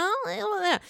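mock tipsy whimpers; drunk groan. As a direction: mimic a sloppy drunk mumble. Imitating drunk Brandy going to bed.

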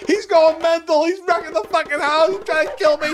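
high, frantic, hammed-up cry for help; panicked plea. Neighbor phoning for help with the chimp, panicked. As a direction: play the terrified neighbor screaming into the phone. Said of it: "She calls, like me calling you".

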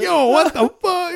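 high, drawn-out whoop of disbelief; retold excited crowd reaction; amazed hollering. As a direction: holler it like a gawking inmate. Retold inmates hollering about the attack.

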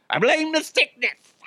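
very high-pitched silly complaint; mock wail. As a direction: blame it in a squeaky, put-on voice. Mock-blames his illness, silly high voice.

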